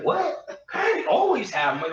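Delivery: high incredulous "what?", then a laugh; surprised. Retold or imitated. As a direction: act amazed he has money. Friend's surprised reply, retold.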